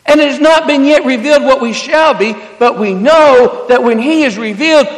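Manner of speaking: loud, driving preacher cadence; preaching at full force. Acted. Preacher pleading with his congregation, loud. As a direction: lift the room with the message.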